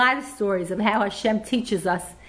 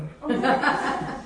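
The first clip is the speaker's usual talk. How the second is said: short real laugh; 3 semitones higher than usual. Real, not acted. After quoting her husband's joke, a short real laugh. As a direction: let a short laugh slip out.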